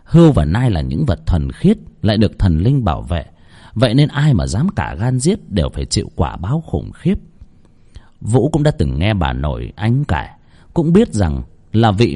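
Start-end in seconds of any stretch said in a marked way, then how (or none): none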